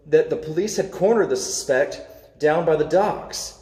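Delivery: plain reading voice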